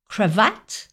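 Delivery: cravate with the stress thrown onto VATTE, ending lifted; final-syllable stress. Retold or imitated. Teacher quoting the pupil, cravate stressed on its last syllable. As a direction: punch and lift the end of cravate. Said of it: "l'accent tonique était sur la dernière syllabe".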